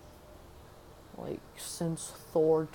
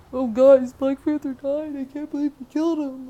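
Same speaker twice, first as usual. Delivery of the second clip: much higher, very loud hammed-up grief; mock dramatic mourning; 9 semitones higher, 13 dB louder than usual. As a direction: overplay the shock over the death. Mocking characters mourning the hero.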